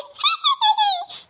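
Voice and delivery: shrill mocking witch laugh; high cackle. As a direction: cackle in mockery. Wife mocks mother-in-law's suit.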